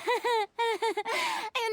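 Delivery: a short, high, self-satisfied laugh; smug laugh. Acted. Former archon laughing off her lost power, smug. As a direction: laugh it off with a flourish.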